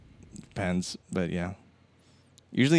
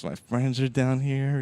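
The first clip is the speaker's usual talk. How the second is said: higher, drawn-out happy boast; great mood; 6 semitones higher, slower than usual. Retold or imitated. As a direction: imitate a man delighted with his lot. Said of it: "in a great mood".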